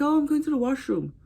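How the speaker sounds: high, sing-song excuse; breezy cover-up. Retold or imitated. Wife excusing herself to hide her crying, breezy cover-up.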